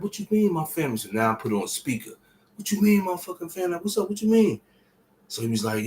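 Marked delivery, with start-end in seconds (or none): 0.0-1.0 much higher, urgent disbelief; demanding to know what happened; alarmed question. Retold or imitated. Alarmed caller demanding answers. 2.6-4.6 fast, very high, desperate questioning; panicked caller demanding the truth; frantic demand. Retold or imitated.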